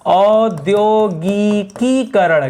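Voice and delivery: औद्योगिकीकरण drawn out syllable by syllable, slow and clear; spelled out slowly. Shown as a demonstration. Teacher drills the correct word for students, slow and deliberate. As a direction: stretch every syllable of औद्योगिकीकरण. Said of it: "औद्योगिकरण नहीं होता है".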